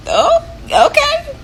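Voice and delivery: high, drawn-out surprised okay; taken aback. Retold or imitated. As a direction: squeak out a slow, hesitant okay, acting taken aback. Taken aback by money offer.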